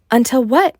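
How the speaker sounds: high, loud, eager question; can't wait to hear what happens; eager cry. Acted. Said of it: "Mulan cried".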